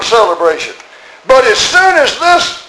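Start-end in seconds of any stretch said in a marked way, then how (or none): 1.3-2.6 slow, high contempt; elder brother sneering at his brother, slow and high; resentful sneer. Acted. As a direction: spit out this with scorn. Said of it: "He said".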